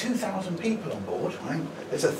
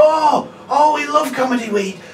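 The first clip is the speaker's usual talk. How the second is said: gushing couple; 10 semitones higher, 9 dB louder than usual. Retold or imitated. Couple gushing about comedy.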